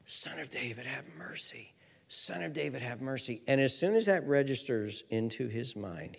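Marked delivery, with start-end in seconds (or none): 0.0-3.4 very soft, low far-off cry; hushed imitation of a distant call; distant shouting. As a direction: mimic shouts heard faintly through noise. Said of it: "he hears these two men shouting".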